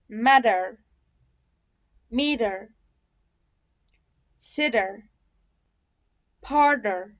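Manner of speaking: "matter", "meter", "sitter" with D for T; American flapped T. Shown as a demonstration. Teacher drilling T plus R examples, flapped T. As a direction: say meter as "meeder", strong R. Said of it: "فده كده صوت ال T".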